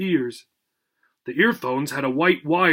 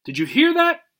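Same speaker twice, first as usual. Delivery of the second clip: high, eager cry; bursting with news; excited; 11 semitones higher than usual. Acted. Old dog excitedly tells Obi the good news. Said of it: "cried Mac excitedly".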